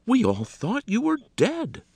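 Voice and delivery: high, loud, drawn-out dismay; aghast. A narrator's reading. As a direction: exclaim it as if facing a ghost. Shocked landlady answers missing man.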